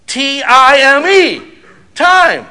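T I M E spelled out slow and loud; T I M E spelled slowly, loud and high. Shown as a demonstration. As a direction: spell T I M E letter by letter, then shout Time. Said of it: "Love is spelled really what?".

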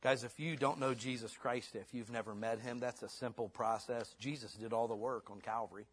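ordinary delivery

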